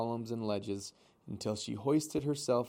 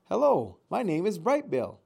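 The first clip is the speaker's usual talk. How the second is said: put-on higher, louder call of a young gosling; young bird shouting a greeting across the water; shouted over the waves; 8 semitones higher, 7 dB louder than usual. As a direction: call out over the surf to be heard. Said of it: "he shouted over the waves".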